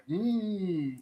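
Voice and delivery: high, soft, long-held "mm"; imitated uneasy "mm". Imitating everybody looking on at the table, uneasy "mm". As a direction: hum it like a stunned onlooker.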